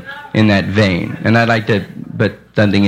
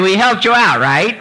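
mocking audience member; 8 semitones higher than usual. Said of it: "they say".

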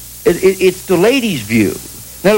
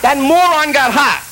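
mock outrage; 12 semitones higher than usual.

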